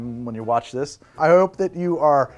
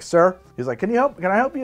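put-on southern accent; 6 semitones higher than usual. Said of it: "I gave him a southern accent".

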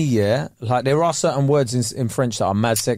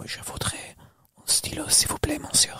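soft whispered French, imitating a woman; woman's voice; 14 semitones higher, 9 dB quieter than usual. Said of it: "If a woman's saying it".

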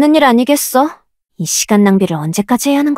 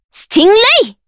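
much higher, ringing; high shouted summon; 9 semitones higher than usual. Acted. Summoner shouting the creature's name high.

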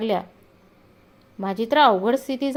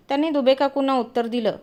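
ordinary delivery, nothing marked

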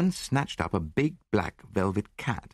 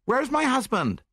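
very high, alarmed woman's voice; frightened cry; 3 dB louder than usual. A narrator's reading. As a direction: cry out anxiously as a worried wife. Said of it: "she cried with a quick, frightened glance".

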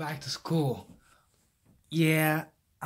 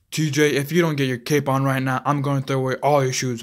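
much louder, fast scolding; rapid-fire angry ultimatum; mom's threat; 9 dB louder, faster than usual. Retold or imitated. Son imitating mom threatening his shoes, loud and fast. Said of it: "she's like".

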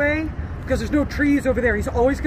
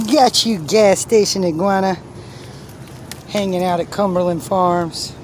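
teasing pet voice; 5 semitones lower than usual. Pretend. Teasing a caught iguana.

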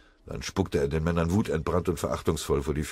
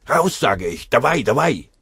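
loud, high shouted order; barked soldier's command; roared command; 6 semitones higher, 7 dB louder than usual. A narrator's reading. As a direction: yell to drive an enemy out of hiding. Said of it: "Eine ihm bekannte Stimme brüllte".

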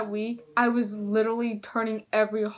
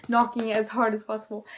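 ordinary talk, nothing marked

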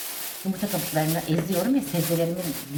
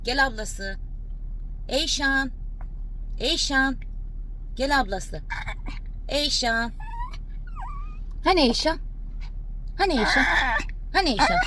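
high, sing-song calls for Eyşan; calling to the dog; 9 semitones higher, 3 dB quieter than usual. Real, not acted. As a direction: call her name high and coaxing. Calling the dog's sister.

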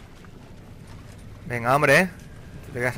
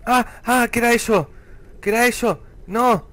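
high, loud, startled questions; startled alarm; 8 semitones higher, 4 dB louder than usual. Real, not acted. Player startled by something unknown, high alarmed voice. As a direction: cry it out high, repeating in alarm.

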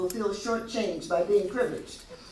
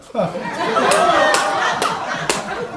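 loud, high burst of laughter; big laugh; 10 dB louder than usual. Real, not acted. Laughing loudly at her own Clarence Thomas jab. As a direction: laugh out hard at the joke.

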